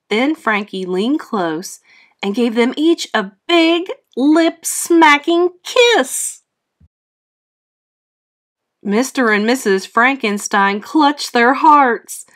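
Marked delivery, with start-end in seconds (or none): 3.5-6.4 lip-smacking stretched slow and high; drawn-out emphasis. A narrator's reading. Narrator savoring the kiss.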